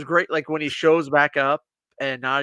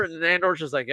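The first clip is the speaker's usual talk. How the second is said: ordinary delivery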